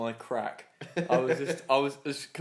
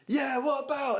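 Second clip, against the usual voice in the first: much higher, gushing mock voice; enthusiastic boss; 12 semitones higher than usual. Big boss greeting him back from the rain, mock enthusiasm. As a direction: imitate an over-keen boss. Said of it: "he was all enthusiastic".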